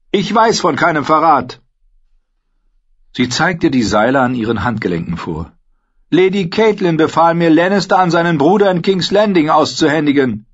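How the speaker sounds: high, loud earnest declaration; proud young woman. A narrator's reading. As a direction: stand tall and claim your oath. Narrator voices Brienne.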